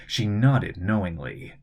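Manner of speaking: usual speaking voice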